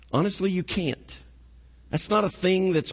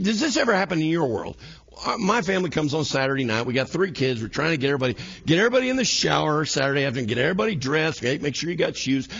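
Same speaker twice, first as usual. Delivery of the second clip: usual speaking voice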